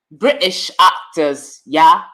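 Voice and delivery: attempted London accent, slow; slow, put-on London English. Shown as a demonstration. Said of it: "trying to do my London accent".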